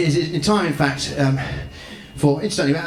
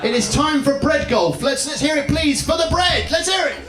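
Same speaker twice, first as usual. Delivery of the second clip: game show announcer; 3 semitones higher than usual. Acted. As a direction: whip the crowd up for the bread. Compère hyping the crowd.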